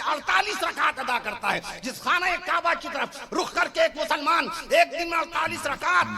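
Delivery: high, forceful sermon delivery; fiery preaching. Real, not acted. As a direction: drive each phrase home to the crowd. Preacher building to a rhetorical point. (پرجوش خطیبانہ انداز، اونچی آواز)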